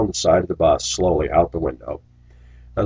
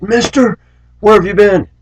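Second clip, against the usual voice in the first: put-on much higher, louder, eager kid voice; excited kids; 14 semitones higher, 6 dB louder than usual. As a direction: call out like a thrilled kid. Kids calling out to driver.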